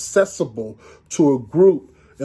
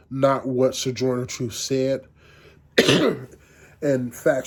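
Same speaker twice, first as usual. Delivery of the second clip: normal talking voice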